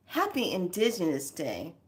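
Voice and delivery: normal talking voice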